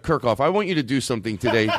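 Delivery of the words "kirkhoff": ordinary delivery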